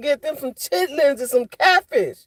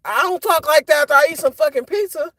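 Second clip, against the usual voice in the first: ordinary talk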